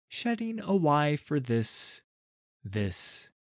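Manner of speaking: plain reading voice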